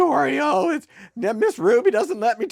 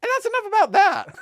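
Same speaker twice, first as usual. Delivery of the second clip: high-pitched catchphrase; 12 semitones higher than usual. Shown as a demonstration. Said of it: "How many times are you gonna say that?".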